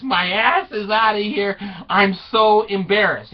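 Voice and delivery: high, loud exasperated outburst; mock mortification. Wife fleeing the newspaper scandal, mock-mortified cry. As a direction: play the humiliated wife.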